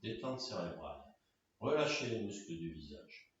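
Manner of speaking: low, very soft and sparse; hushed closing meditation cues; guided relaxation. Real, not acted. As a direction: lull them into deep rest. Guides final rest.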